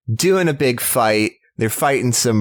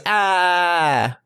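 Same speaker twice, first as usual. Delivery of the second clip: monster cry; 7 semitones higher than usual. Retold or imitated. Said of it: "It goes".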